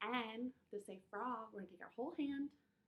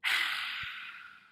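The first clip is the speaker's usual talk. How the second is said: forceful, airy dragon exhale; dragon's breath; 7 dB louder than usual. Shown as a demonstration. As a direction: let all the fire out in one breath. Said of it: "Let's do it one more time".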